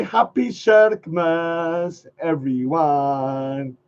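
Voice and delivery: slow demo greeting. Shown as a demonstration. Teaching viewers the new greeting, slow and drawn out. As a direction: draw out Happy Shirkmas slowly. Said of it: "if you say".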